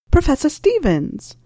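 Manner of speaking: bright, slow call across the cafe; surprised greeting. Acted. Calling out to him in a cafe.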